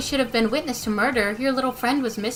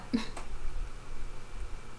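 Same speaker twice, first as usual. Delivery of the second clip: quiet laugh; 11 dB quieter than usual. Real, not acted. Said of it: "What's with the surreal decor".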